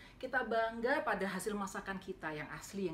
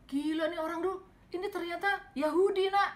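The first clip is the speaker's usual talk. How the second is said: high and much louder, amazed; excited revelation; 9 semitones higher, 9 dB louder than usual. Retold or imitated. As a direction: announce the discovery in astonishment. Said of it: "Terus dia bilang".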